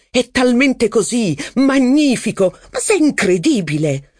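high, slowed, gushing praise; delighted exclaiming. A narrator's reading. Robert applauding his friend's clever remark, exclaiming with delight. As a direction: cry out in delight, almost choking with laughter. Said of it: "ridendo da soffocarsi ed esclamando".

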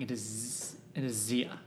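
low, hushed, giving up the name; quiet reluctant admission. Acted. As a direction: let the name slip quietly. NPC blurts her real name.

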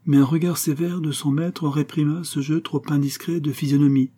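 ordinary delivery, nothing marked

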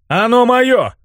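very high, insistent protest; outraged cry. A narrator's reading. Hero defends his own body, crying out. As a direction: claim it as yours, pitched right up. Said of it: "закричал я".

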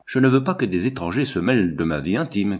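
usual speaking voice

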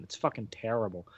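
normal talking voice

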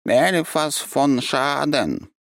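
high, very slow, solemn; creature's name. A narrator's reading. Cat finally names himself to the archmage, high and slow. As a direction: pronounce the name slowly and grandly. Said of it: "наконец произнёс кот".

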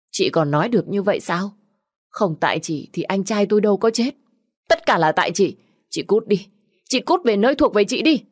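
high, loud furious yelling; screaming again. Acted. Sister-in-law screams the widow out, furious. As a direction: drive her out with every word.

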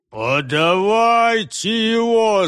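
slow, drawn-out command; eager shout. A narrator's reading. Head grabbing the captive, eager loud command. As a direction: demand the prisoner loudly. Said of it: "закричал голова".

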